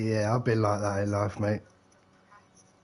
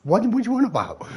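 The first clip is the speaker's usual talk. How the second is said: high, laughing disbelief; laughing at an absurd demand; incredulous laughing; 10 semitones higher than usual. Retold or imitated. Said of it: "it's like".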